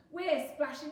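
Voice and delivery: loud, high call; gleeful shouting. A narrator's reading. Friends in the river to Fox, loud gleeful shout. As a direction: call out from the water. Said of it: "they shouted".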